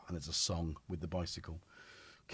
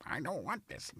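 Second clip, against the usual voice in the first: ordinary delivery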